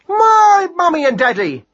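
very high, loud, slow correction; exasperated mad hare. Acted. Hare corrects mouse about whose parents died, exasperated. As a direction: insist on it, pitched very high.

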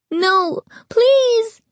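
high, frightened pleading; begging. A narrator's reading. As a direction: beg in panic. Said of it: "Noah begged".

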